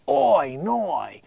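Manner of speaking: exaggerated Australian "oi, noi"; Australian accent. Shown as a demonstration. Comedian shows listeners how to get into Australian accent. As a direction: stretch the vowels in a mock Australian accent. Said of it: "you can get into the Australian".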